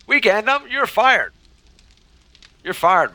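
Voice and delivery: loud, high-pitched mock outrage; mock firing. Streamer playfully sacking a helper, mock outrage.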